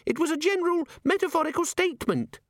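very high, chirpy little device voice; tiny imp chiming. A narrator's reading. The pocket organiser imp announcing itself to Vimes, chirpy. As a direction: sing out the chime like a small gadget.

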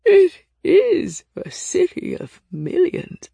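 slow, high, loud ancient creak; old, scratchy voice. A narrator's reading. Nameless explains the many priests to Nick, old and creaky. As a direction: declaim it slowly in a cracked old voice. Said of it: "their voice so old and scratchy".

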